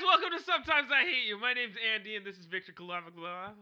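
loud, high podcast-host greeting; shouted show intro. Acted. Co-host interrupts to open the show, shouted intro. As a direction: burst in yelling, then ease down.

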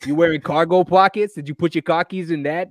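mock thick Boston accent; teasing Boston accent. Teasing his cousins about their accent, mock Boston accent. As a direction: rib them in an exaggerated Boston accent. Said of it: "I give my cousins shit all the time".

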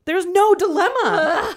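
high, loud, half-laughing, half-crying outburst; laughing in disbelief. Real, not acted. Fan ranting about a book's non-dilemma, laughing in disbelief. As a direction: crack up in exasperated disbelief.

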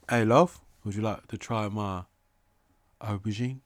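woo them in a put-on British accent. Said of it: "an accent that's not an American accent".

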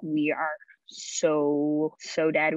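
slow, stretched retold dismay; drawn-out dread. Child to sibling facing trouble, slow retold dread. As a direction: draw out each word with mild dread.

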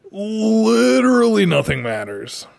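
very high, long-stretched "Literally", sliding down; hammed-up singsong exasperation; exaggerated mock dismissal. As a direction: wail the first word, then let it drop. Same dismissal repeated to friend.

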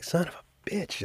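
soft muttered curse under the breath. Retold or imitated. Uncle seeing blood on his niece's face, muttered curse. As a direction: curse quietly to yourself. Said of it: "So I'm like".

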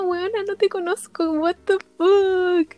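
high, slowed-down disbelief, stretching what the fuck; incredulous retort. Retold or imitated. Teller replaying her reaction to the pushy stranger, high and incredulous. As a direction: play it as total disbelief at a stranger. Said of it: "Y yo como".

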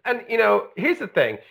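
usual speaking voice

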